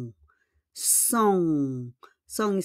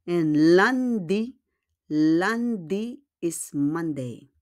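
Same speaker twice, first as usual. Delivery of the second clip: slow lesson; slower than usual. Shown as a demonstration. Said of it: "Then we have pa".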